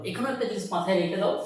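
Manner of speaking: ordinary talk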